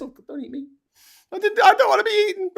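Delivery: high, fast mock terror. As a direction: squeal in pretend fright. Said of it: "I'm like this".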